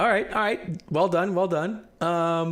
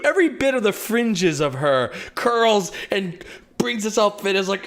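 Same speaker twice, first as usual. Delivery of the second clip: normal talking voice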